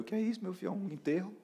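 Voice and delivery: high-pitched, puzzled retold question; startled apostle. Apostle seeing weeping disciples, mock bewildered. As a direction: act out baffled, high questioning.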